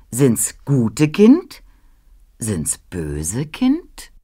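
langsam und immer tiefer, dunkler; drohende Frage. Acted. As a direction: dehne jedes Wort, zum Ende düster und leise. Ruprecht fragt drohend nach Kindern. (langsam drohend fragender Knecht Ruprecht)

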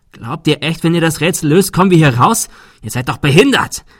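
losing it, voice climbing in panic; panicky yelling. Acted. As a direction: Adrian shouts at the group in rising panic. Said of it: "Er wurde laut und klang panisch".

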